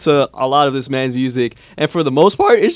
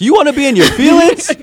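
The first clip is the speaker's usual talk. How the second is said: mock incredulous; 10 semitones higher, 8 dB louder than usual. Mocking [name] fans, loud.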